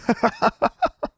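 high, staccato chuckle; mocking laugh. A narrator's reading. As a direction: laugh scornfully in short bursts. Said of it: "The two Aurors laughed".

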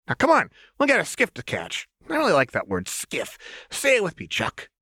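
put-on high, sing-song playful girl; high chirpy menace; manic Jinx voice. A narrator's reading. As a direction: tease the hostage brightly.

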